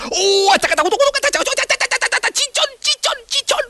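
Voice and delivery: yelp of pain. Acted. Piedras caen en la cabeza.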